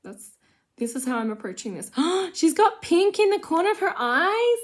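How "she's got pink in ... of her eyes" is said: loud, high gasp of astonishment; gasp, then exclaim it high and loud; shocked discovery. Real, not acted. Gasping at discovery on the doll.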